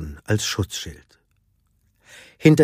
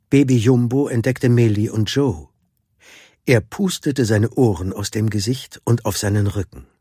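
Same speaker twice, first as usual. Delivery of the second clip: ordinary delivery, nothing marked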